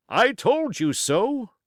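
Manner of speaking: high, loud and slow, as if calling across the room; captain cries out his told-you-so; cried out. A narrator's reading. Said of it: "cried the captain".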